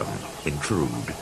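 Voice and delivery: sheepish. A narrator's reading. Thomas embarrassed excuse.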